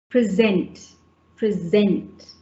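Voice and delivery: present, present, each said pre-ZENT, falling; stress on second syllable. Shown as a demonstration. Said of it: "it's on the second syllable".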